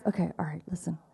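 a hushed whisper to the room; whispered aside. Real, not acted. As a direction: lean in and whisper it. Aside to the congregation.

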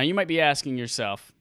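ordinary talk, nothing marked

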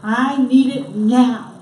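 slow, loud, insistent demand; impatient demand. Acted. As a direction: drag it out, insisting on NOW. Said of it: "There's no sense of long-term gratification".

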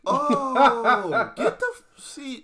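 laughing through a mock reaction; high-pitched giggling words.

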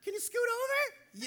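very high cartoon squeak; Mickey Mouse. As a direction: stammer timidly in a high falsetto. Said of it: "little Mickey Mouse".

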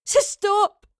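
high, loud plea; distressed cry. A narrator's reading. Girl overwhelmed by forced memories, distressed cry. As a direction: cry out to make it end. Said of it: "she heard herself saying aloud".

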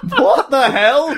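high, loud, slow exclamation through laughter; mock outrage. Podcaster lost in the absurd tangent, mock outrage. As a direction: cry out in mock disbelief, drawn out and high.

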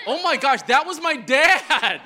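mock shocked realization. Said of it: "Because I realized".

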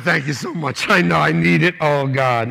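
overwrought thanks. Retold or imitated. Dad answering his son's comforting words, overwrought thanks. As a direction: pour it out in mock tearful relief. Acted emotional thanks to son.

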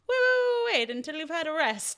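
high-pitched mock halt, easing down; urgent halting call. Swordsman stopping his rival from drawing, high mock-urgent halt. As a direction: jump up high on the waits, then settle.

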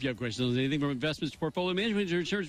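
quick radio ad-read patter; fast ad read. Acted. Host reading the sponsor ad fast to listeners.

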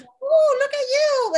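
put-on very high squeaky baby talk. Owner petting her animal, squeaky baby talk. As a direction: coo at a little pet.

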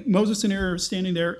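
ordinary delivery

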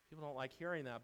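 ordinary talk

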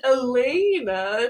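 "Elena" drawn out slowly; "Elena" slowed as if in air quotes; name in quotes. Retold or imitated. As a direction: stretch "Elena" with knowing emphasis. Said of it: "in quotes".

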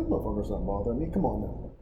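a lightly voiced unbothered shrug; brushing it off. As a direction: wave it away easily. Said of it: "I'm like".

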